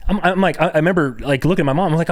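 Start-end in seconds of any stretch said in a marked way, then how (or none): none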